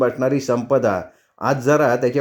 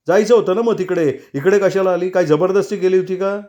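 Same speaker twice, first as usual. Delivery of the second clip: heated retort; 6 semitones higher than usual. Acted. Heated quarrel continues.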